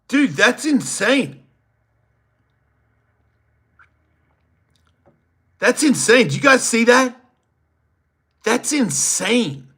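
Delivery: high, loud amazed yelling; stunned, can't believe what he's seeing; excited. Real, not acted. Reacting to an insane clip, loud and amazed. Hyped reaction to something amazing.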